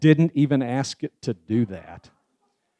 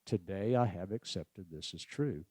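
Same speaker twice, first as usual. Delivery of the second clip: "Today I have accepted this as true." said much softer and lower; quiet ten count; 5 semitones lower, 13 dB quieter than usual. Shown as a demonstration. Speaker repeating the second verse softly in ten count. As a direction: let the verse fall to a hush. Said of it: "Another ten count".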